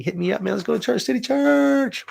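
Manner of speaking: eager high call to join; excited invite. Real, not acted. Inviting viewers to church.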